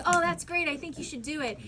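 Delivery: upbeat retold reply. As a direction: imitate an encouraging co-star, starting high and loud. Retells co-star's upbeat reply.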